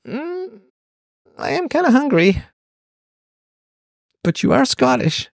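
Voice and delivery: high, hammed-up voice of a friend's parent; lightly put-on voice; mock Scottish host. A kid mimics a Scottish friend's parent, hammed-up.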